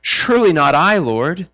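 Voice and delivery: anguished but restrained protest; deeply grieved. A narrator's reading. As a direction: ask it in grieved disbelief. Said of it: "Being deeply grieved".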